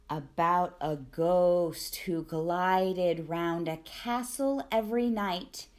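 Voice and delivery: ordinary delivery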